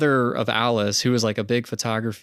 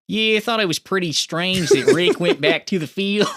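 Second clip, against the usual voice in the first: higher, louder mock Australian coworker; hammed-up Australian accent; 7 semitones higher, 5 dB louder than usual. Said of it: "I wanna hear your Australia voice".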